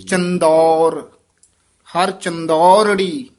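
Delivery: slow and loud ਚੰਦੌਰ, then ਹਰ ਚੰਦੌਰੜੀ, with a pause after ਚੰਦੌਰ; teacher's loud, slow model of ਚੰਦੌਰੜੀ; emphatic syllable split. Shown as a demonstration. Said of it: "ਚੰਦੌਰ ਪੋਲਾ ਵੀ ਨਹੀਂ ਬੋਲਣਾ ਹੈ".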